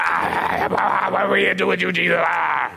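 hammed-up demon wail, rushed words. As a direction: howl like a demon, gabbling the middle. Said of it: "That's my demon voice".

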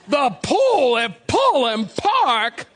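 slow, popping P's in "pool", "Pullen" and "Park"; accentuated P's. Retold or imitated. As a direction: hit each P hard in "pool", "Pullen", "Park", drawing the words out. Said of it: "they accentuate those P's".

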